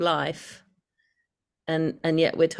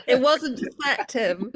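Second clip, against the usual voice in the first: laughing protest, higher; kind gentle laugh; 7 semitones higher, slower than usual. Real, not acted. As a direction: say it through a giggle. Laughing at his joke.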